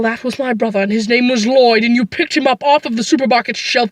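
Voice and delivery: normal talking voice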